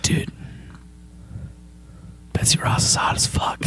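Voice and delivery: hushed bro confession, breathy and low; frat bro whisper. Acted. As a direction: whisper it like a horny frat guy. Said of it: "he's like".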